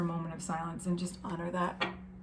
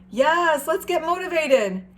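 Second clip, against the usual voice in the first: high, loud pep-talk burst; excited cheer; 8 semitones higher, 7 dB louder than usual. Real, not acted. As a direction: fire them up.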